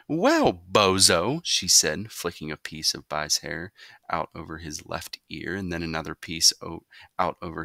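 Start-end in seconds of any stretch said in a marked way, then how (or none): 0.1-1.4 slow, drawn-out mock; teasing. A narrator's reading. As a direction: tease him by nickname. Mother teases son.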